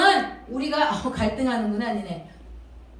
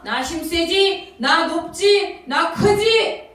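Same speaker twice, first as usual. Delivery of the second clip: slow, drawn-out boasting, building louder; boastful false king; 3 dB louder, much slower than usual. Acted. Devil posing as a king to impress children, boastful. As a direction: show off like a rival king, stretching each phrase. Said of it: "다른 왕의 모습으로 나타나서".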